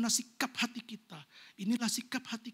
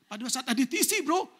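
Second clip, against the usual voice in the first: ordinary delivery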